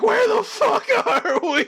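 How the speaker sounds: very high, loud, drawn-out cry; panicked wail. Acted. People fallen out of empty space, panicked. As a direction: wail it in hammed-up hysterics.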